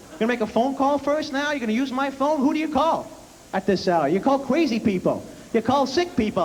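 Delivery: high, alarmed tattling dad; father complaining to wife Lil about the TV dial; dad calling mom. Retold or imitated. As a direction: call to the wife in a panic. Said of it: "my dad goes".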